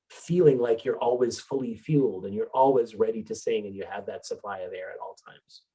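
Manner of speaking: usual speaking voice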